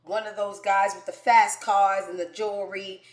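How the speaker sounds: ordinary talk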